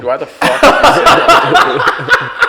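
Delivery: high, slow, laughing outrage; mock outraged shriek. Real, not acted. Friend shrieks at a joke that went too far, mock outraged. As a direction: shriek it in disbelief, half laughing.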